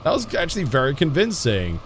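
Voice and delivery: usual speaking voice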